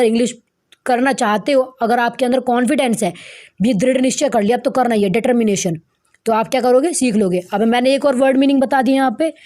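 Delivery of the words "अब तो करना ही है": ordinary delivery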